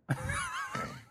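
very high-pitched giggle; high squealing laugh. Real, not acted. Laughing after joke.

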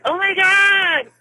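very high, loud, slow squeal; imitating the host's delighted recognition; squealed oh my God. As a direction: stretch it out in a giddy squeal. Celebrity recognizing caller.

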